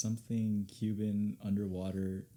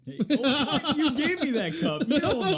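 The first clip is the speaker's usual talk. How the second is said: loud, high-pitched protest through laughter; laughing protest; 11 dB louder than usual. Real, not acted. Guest objects to the host about the cup, laughing loudly. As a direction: protest loudly while cracking up.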